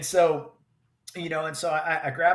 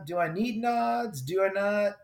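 wondering aloud; 6 semitones higher than usual. Retold or imitated. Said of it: "that time when it's like".

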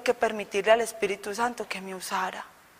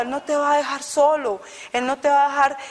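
ordinary talk

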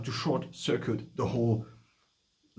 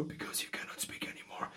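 voiceless whisper of a worn-out voice; lost voice; 14 dB quieter than usual. Shown as a demonstration. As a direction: whisper as if your voice is gone. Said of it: "your voice just sounds like nothing anymore".

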